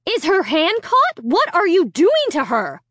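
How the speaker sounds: put-on a mother frightened for her child; screaming mother. A narrator's reading. Mother screaming at the boy holding her daughter. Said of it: "Alicia's mother was screaming".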